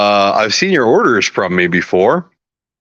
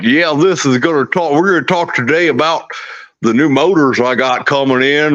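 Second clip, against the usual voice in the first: put-on Texas accent; 3 semitones higher than usual. Texan showing off new motors to viewers, hammed-up Texas accent. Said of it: "I never got that accent".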